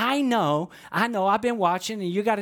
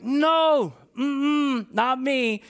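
high, louder, drawn-out refusal; emphatic refusal; 7 semitones higher, 3 dB louder, much slower than usual. Retold or imitated. Preacher acts out Peter balking at the vision, emphatic refusal. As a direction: balk firmly in a raised pitch.

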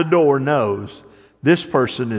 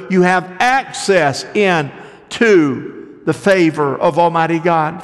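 rising, louder preacher's call; building sermon delivery; full-force preaching; 5 semitones higher, 4 dB louder than usual. Acted. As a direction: preach it out to the crowd.

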